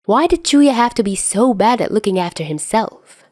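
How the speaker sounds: ordinary delivery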